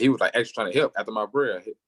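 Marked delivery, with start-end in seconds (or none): none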